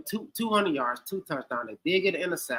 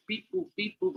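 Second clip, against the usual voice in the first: computer noises; 2 semitones higher, 7 dB quieter than usual. Pretend. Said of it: "like the computer used to do in the eighties".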